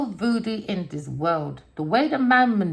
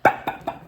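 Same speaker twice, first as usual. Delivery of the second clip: kicking sounds. Retold or imitated.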